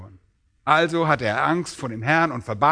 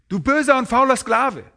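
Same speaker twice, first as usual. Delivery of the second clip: higher, louder scornful master; master's scornful rebuke; 9 semitones higher, 4 dB louder than usual. Shown as a demonstration. Preacher acting the master scolding his servant, scornful. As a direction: throw the insult at the lazy servant with contempt. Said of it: "ich versuche das mal so vorzulesen, wie es hier wohl beabsichtigt ist".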